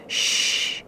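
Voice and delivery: breathy hush; whispered shush. A narrator's reading. Mother lemming hushing her family. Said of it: "she whispered".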